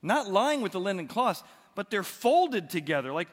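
loud, high, driving sermon voice; preaching at full force. Acted. Preaching the empty tomb at force.

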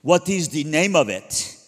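loud, slow bellow from bed; dad yelling. Retold or imitated. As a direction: call it out through the door, stern. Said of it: "just yells really loud".

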